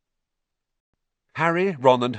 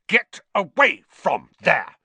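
slow, loud, high command; officious telling-off; scandalised order; 9 semitones higher, 7 dB louder than usual. A narrator's reading. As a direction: order them off, word by word. Percy chivvies them away.

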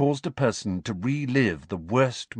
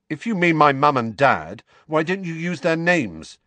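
higher, indignant challenge; raised-voice boy's retort; loud; 8 semitones higher, 5 dB louder than usual. A narrator's reading. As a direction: demand it loudly, offended. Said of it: "said Harry loudly".

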